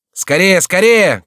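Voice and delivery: high, loud, drawn-out urging; slow, stretched commanding yell; shouting. A narrator's reading. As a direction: shout to hurry a lagging rider. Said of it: "кричал Шико".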